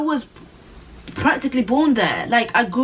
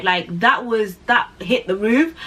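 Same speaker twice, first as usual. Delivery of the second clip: ordinary delivery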